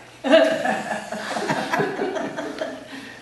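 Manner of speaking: long, high burst of laughter; big laugh. Real, not acted. Old man laughing at being called a little aristocrat. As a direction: really crack up at the punchline.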